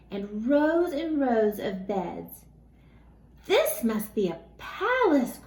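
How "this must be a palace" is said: high, awed gasp of amazement; gasped in wonder. Acted. Teddy bear amazed at the furniture floor, high and awed. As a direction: breathe it out in sheer wonder. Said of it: "Corduroy gasped".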